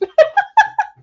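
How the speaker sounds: loud high laugh. Real, not acted. Streamer after teasing partner about touching their face, laughing. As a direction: burst out laughing.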